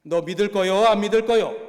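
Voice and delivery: retold prisoner shouting at an inmate to choose faith; threatening shout. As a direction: yell the ultimatum at the cowering inmate. Go Jae-bong demands an answer.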